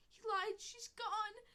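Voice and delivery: high, loud anguished wail. A narrator's reading. Kit realizing mother is gone, high loud anguished wail. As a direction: cry it out in despair. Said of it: "Owl Kit wept".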